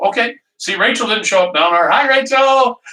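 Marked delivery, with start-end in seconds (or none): 1.9-2.8 high, bright-up hello to a friend; excited greeting. Real, not acted. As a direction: call out hi to a buddy. Greeting a viewer on stream.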